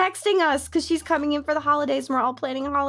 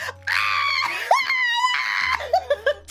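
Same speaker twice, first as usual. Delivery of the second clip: high laughing shriek, half crying; laughing scream. Retold or imitated. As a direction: shriek with laughter at each other. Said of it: "We were screaming".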